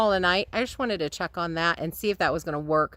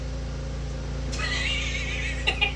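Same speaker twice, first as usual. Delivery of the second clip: high delighted laugh; 4 dB quieter than usual. Real, not acted. Moth chase with kittens.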